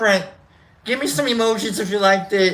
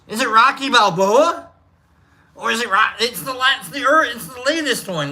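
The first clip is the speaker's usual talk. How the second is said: ordinary delivery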